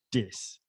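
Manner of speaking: soft dis in place of this; a quiet dis, d for th; kid saying dis. Retold or imitated. Boy points to his rice, says dis. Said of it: "he couldn't pronounce the T H".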